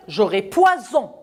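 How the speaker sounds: ordinary talk, nothing marked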